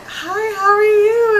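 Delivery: very high, loud, stretched-out hello; sing-song it way up high, slowly; mimicking a star greeting a fan; imitated sweet greeting. Acting out the star's greeting.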